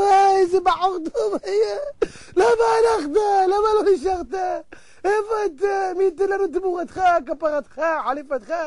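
put-on tearful eulogy, pitched high; mock wailing. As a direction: wail theatrically over the dead. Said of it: "איזה בוכים".

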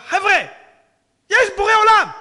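high, loud cry to the crew; excited shout. Retold or imitated. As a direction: yell the discovery at the crew. Soldier calling out in tank.

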